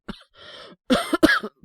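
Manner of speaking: harsh feverish cough; loud rasping sickbed cough. Acted. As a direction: cough hard from a sore chest. Sick boy in bed.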